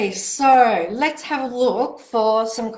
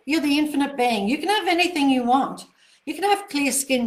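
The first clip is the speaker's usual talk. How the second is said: excited encouragement; 6 semitones higher, 4 dB louder than usual. Real, not acted. Cheering a viewer on.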